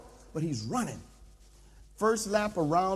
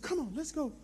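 high, softer coach urging; trainer's voice; 7 semitones higher, 3 dB quieter, faster than usual. Acted. Trainer coaxing the tired pastor onward, high and soft. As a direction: coax onward in a raised voice. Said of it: "he said".

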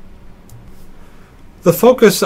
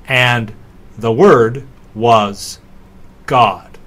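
ordinary talk

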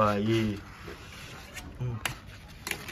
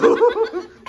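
very high burst of laughter; shrieking laugh; 7 dB louder than usual. Real, not acted. Joke about gossiping neighbours.

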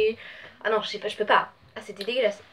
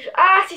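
disgusted yelp; 8 semitones higher, 7 dB louder than usual. Real, not acted. Smells the bad bean.

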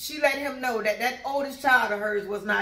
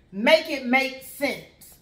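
each word slow and deliberate; slow punchline; 4 dB louder, much slower than usual. Real, not acted. Calling out the coworker's hypocrisy, slow and pointed. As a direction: spell it out slowly.

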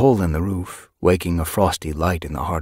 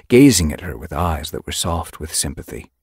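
ordinary delivery

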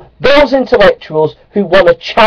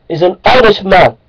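ordinary delivery

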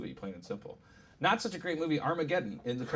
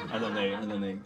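ordinary talk, nothing marked

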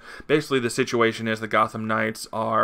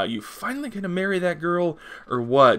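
put-on teasing girl; 7 semitones higher than usual. Gotham Girl voiced teasing Batman.

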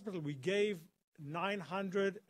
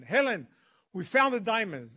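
loud, raised-pitch big news; emphatic and loud, pitched up; retold excited announcement; 4 semitones higher, 11 dB louder than usual. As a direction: reenact breaking the news at full volume. Shouting the news back to Helen.

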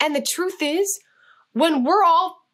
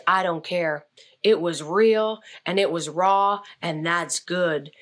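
Clint voice; 4 semitones lower than usual. Retold or imitated. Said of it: "And he goes".

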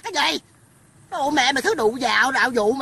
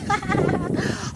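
higher-pitched giggling laugh; amused laugh; 5 semitones higher, 3 dB quieter than usual. Real, not acted. Vlogger admitting it's an empty lot, giggling laugh. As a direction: laugh out at your own joke.

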